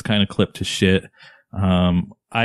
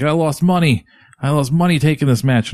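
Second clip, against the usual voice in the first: high-pitched wrestler promo imitation; mock promo voice, pitched up; Owen Hart; 7 semitones higher, 3 dB louder than usual. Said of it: "Owen Hart gives this promo and he's like".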